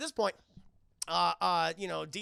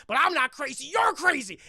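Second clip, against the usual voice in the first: high, loud, unhinged shout; ranting agent; 8 semitones higher, 12 dB louder than usual. Retold or imitated. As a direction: yell it like a paranoid madman.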